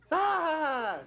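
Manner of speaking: high drawn-out mock "aah"; pained cry. Gift giver hears of friend's fourteen million, pained cry. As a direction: let out a long, high comic wail.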